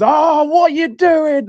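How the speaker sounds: high, slow mock moan at the players; pretend annoyance. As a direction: fake a frustrated fan's moan, slowly. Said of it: "pretending I'm annoyed".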